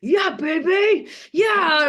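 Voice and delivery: loud, high, stretched-out whoop; triumphant gym-buzz yell; pumped-up cheer. Retold or imitated. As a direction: shout it like you're hyping yourself up. Acts out pumped reply to compliments.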